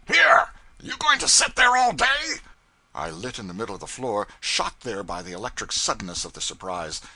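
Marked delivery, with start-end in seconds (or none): 0.1-2.4 very high, loud furious shriek. A narrator's reading. Pilot shrieking at the idle cub, very high and loud. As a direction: screech it at him out of nowhere. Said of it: "Now came this shriek".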